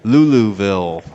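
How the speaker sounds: loud, drawn-out Louisville caricature; playful Louisville-accent imitation; mock Louisville accent. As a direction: tease with an exaggerated Louisville pronunciation. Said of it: "I'm not making fun of people from Louisville".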